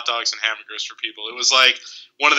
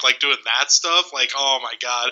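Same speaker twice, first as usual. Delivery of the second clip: ordinary talk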